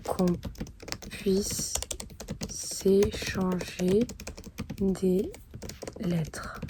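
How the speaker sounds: slow, halting words with long pauses; dictating while writing. Real, not acted. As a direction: say each phrase as you write it down. Composes letter aloud while writing it.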